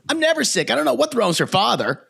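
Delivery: high-pitched imitation of a mother; mother impression. Daughter quoting what mother always says, high-pitched mother impression. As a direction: imitate mom's protest, pitched well up. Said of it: "my mother always says".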